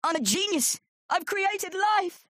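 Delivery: high, crowing shout, last words drawn out; triumphant shout. A narrator's reading. Terry boasts over his new sea monkeys, triumphant shout. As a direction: proclaim it like a mad inventor. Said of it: "he shouted".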